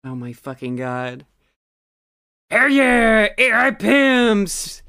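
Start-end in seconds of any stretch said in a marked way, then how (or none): 2.5-4.8 loud, high hollering voice; full-throated, drawn-out mock yell; Connor O'Malley. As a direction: holler it, stretching out the last word. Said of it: "clearly Connor O'Malley's voice".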